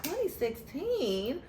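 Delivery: stretched-out incredulous question; disbelieving echo. Retold or imitated. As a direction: drag it out like you can't believe it. Ladies repeat the date back.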